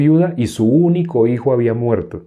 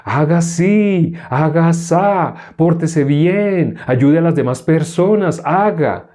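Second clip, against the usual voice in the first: higher, slow, drawn-out singsong orders; mock religious commanding; 5 semitones higher, slower than usual. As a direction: parody religion handing out rules. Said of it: "¿Qué dicen las religiones?".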